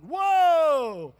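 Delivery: loud, stretched astonished whoa; amazed whoa. Retold or imitated. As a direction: gasp it out, wide-eyed. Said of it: "It's like".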